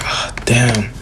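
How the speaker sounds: under-breath whispered grumble; whispered curse. Retold or imitated. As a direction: mutter it to yourself. Neji frustrated under his breath.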